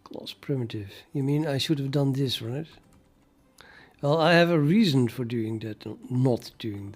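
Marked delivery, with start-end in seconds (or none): none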